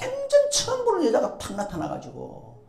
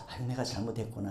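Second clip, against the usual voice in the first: low, soft murmur to oneself; inward self-reproach; 11 semitones lower, 9 dB quieter, faster than usual. Retold or imitated. A person at fault thinking inwardly, low soft regret. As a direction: quietly imitate a private admission. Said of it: "내가 속으로".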